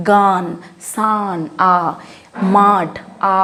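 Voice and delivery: "গান, সান, মাড" with "আ" isolated after. Shown as a demonstration. Teacher checking the sound with learners, "গান, সান, মাড" with aa. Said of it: "আ এর মতো উচ্চারণ করছি না?".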